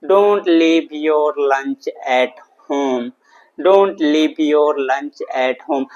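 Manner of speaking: very slow, each word stretched out and separated; slow model sentence. Shown as a demonstration. English teacher giving students the answer, slowly and deliberately drawn out. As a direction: dictate it word by word for learners.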